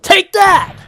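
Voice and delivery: high triumphant shout. Acted. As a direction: yell it out in triumph. Courtroom game line called out.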